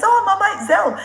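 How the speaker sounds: high, fast, loud mock-tearful gush; emotional fan. Overwhelmed fan confessing to the traveller, high imitated tearful gush. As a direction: gush it squeakily through laughter. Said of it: "I'm not normally this emotional".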